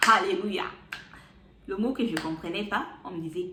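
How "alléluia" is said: a loud relieved cry of hallelujah; alleluia exclamation. Retold or imitated. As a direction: burst out louder.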